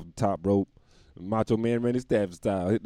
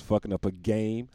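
ordinary talk

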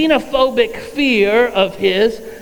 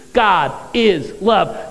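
slow recital; much slower than usual. Shown as a demonstration. Quoting the children's first verse.

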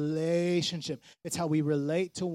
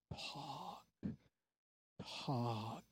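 hushed emphasis; 4 semitones lower, 16 dB quieter than usual. Shown as a demonstration. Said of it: "emphatic soft repetition".

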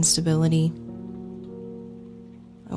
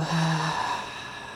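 long, breathy sigh out; audible exhale; 6 dB quieter than usual. Shown as a demonstration. Meditation guide showing listeners the exhale, long audible sigh. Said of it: "an audible exhale".

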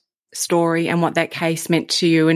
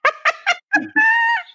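a high shrieking burst of laughter. Real, not acted. Host cracking up at the guest's story with a high shriek. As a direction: let out a squealing laugh.

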